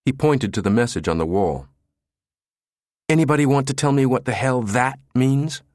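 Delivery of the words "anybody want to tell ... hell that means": higher, heated challenge; exasperated. A narrator's reading. Jabba challenges everyone over the message, exasperated. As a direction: throw it out to the room, exasperated.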